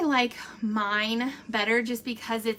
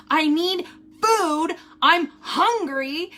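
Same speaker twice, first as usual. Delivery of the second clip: mimicking husband; 6 semitones higher, 6 dB louder, slower than usual. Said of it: "He just keeps going".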